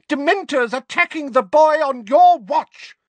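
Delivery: high, loud accusation; shriek of rage. A narrator's reading. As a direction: shriek the blame at the slacker. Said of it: "shrieked Missus Figg".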